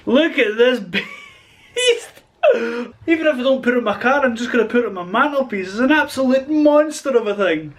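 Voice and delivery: high, giggly amazement; cracking up. Real, not acted. Holding the turbo beside his head, cracking up. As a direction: laugh through the words, pitch riding up. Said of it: "I just keep cracking myself up".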